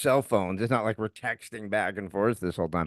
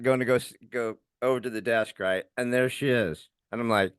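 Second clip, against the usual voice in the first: usual speaking voice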